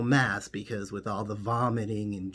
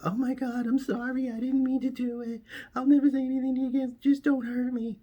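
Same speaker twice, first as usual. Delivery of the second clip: shrieking much higher in mock terror; hammed-up hysterical shriek, begging for mercy; panicked screaming; faster than usual. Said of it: "and started screaming".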